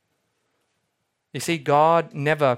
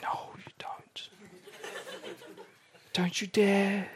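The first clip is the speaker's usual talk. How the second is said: hissed whisper, much softer, slow; mock warning whisper; 11 dB quieter, slower than usual. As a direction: whisper a playful threat under the breath. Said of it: "Eagle One and his team is like".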